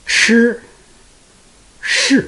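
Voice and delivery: shi held high and level; first tone shi. Shown as a demonstration. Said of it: "first tone".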